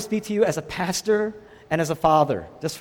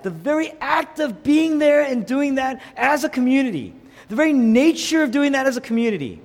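high, loud, insistent sermon delivery; forceful preaching; 6 semitones higher, 6 dB louder than usual. Real, not acted. Preacher calling the whole church to make disciples, forceful preaching. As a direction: press the call on every listener.

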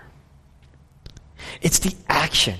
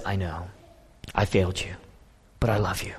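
soft confession; 9 semitones lower, 9 dB quieter than usual. Acted. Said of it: "And Peter says".

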